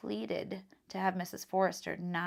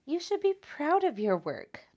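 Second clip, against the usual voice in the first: high, louder warm praise; kind librarian; 8 semitones higher, 7 dB louder than usual. Acted. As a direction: praise Tom as the proud librarian. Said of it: "the librarian said kindly".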